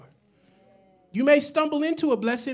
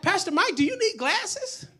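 lifted, higher voice for the friend; imitated friend's question; 8 semitones higher than usual. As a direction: quote a friend's puzzled question, pitch climbing.

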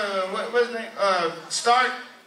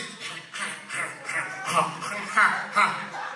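short, repeated half breaths; imitated labored breathing; 5 dB quieter than usual. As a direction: puff softly in mock wheezy little gasps. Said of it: "the ones that breathe like this".

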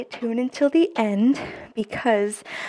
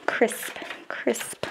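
whispered; 7 dB quieter than usual. Real, not acted. Savoring crisp new bills.

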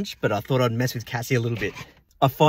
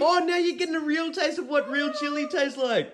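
mocking taunt; 12 semitones higher than usual. Real, not acted. Taunting her over the chili.

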